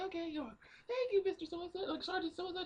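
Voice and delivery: put-on very high, quick little-girl falsetto. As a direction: imitate a grateful child in squeaky falsetto. Acting little girl thanking the sergeant.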